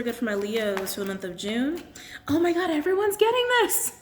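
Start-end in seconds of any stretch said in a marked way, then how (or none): 2.3-3.9 much higher, louder, amazed exclamation; high thrilled "oh my God"; surprised delight. Real, not acted. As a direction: gasp it out, genuinely amazed. Surprised at repeated crystal result.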